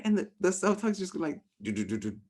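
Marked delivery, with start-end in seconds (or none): none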